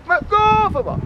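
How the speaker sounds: shouted high cheer, stretched slow; booming stadium cheer. Acted. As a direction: chant it like a fan in the stands. Repeated cheer per territory.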